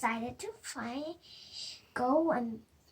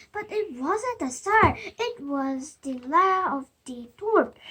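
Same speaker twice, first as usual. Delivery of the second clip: plain reading voice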